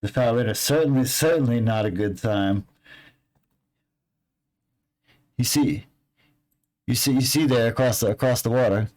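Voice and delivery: hint at dark secrets, fishing for payment; ominous local NPC. Acted. NPC lore-telling ominously.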